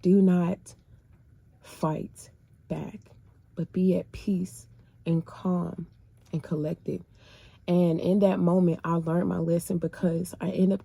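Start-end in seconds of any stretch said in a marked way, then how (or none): 0.0-7.0 voice of the Lord. Retold or imitated. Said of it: "the Lord was revealing to me".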